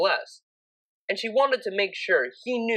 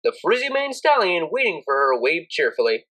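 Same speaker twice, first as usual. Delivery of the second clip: ordinary delivery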